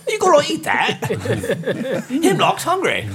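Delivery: high, slow, laughing stage voice; English character voice. Acted. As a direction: ham it up as the hungry detective. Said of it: "I'm like".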